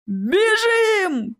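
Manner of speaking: high, drawn-out yell of alarm; panicked yell. A narrator's reading. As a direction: yell it out in a voice not your own. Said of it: "не своим голосом заорал". (панический вопль не своим голосом)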